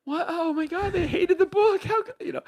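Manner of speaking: squeaky falsetto, hammed-up horror; mock panic. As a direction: shriek it like a crushed new author. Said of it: "I was like".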